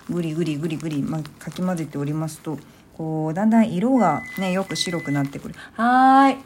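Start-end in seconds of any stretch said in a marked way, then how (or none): 5.7-6.4 loud. Real, not acted. Answering the microwave beep.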